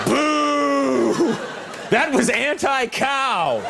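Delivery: boo them like an offended cow lover; mock outrage.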